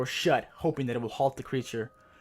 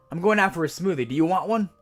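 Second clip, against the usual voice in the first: casual friendly offer; 7 semitones higher, 7 dB louder than usual. Acted. Casually offering the creature a smoothie.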